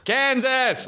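high-pitched American holler of a place name; big, wide American call; mock American shout. Shown as a demonstration. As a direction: call it across vast open plains. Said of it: "you have to shout".

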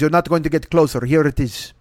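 rough Russian-driver impression; mock Russian accent. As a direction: deliver it in an amateur Russian accent. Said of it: "I don't do accents".

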